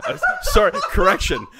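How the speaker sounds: laughing through a self-correction; giggling as she corrects herself. Real, not acted.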